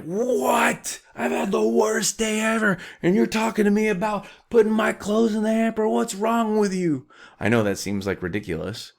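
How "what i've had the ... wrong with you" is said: high, outraged whiny squeal; hammed-up indignant squeal over a small request; mock overreaction. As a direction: play the offended sulker, high and indignant. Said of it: "you're like".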